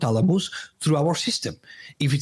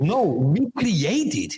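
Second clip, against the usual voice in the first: slow, higher, stressing "create"; "create" stretched out in a slow correction; emphatic correction; 5 semitones higher, slower than usual. Real, not acted. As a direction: correct them, drawing out "create" firmly. Corrects host's word "internalize.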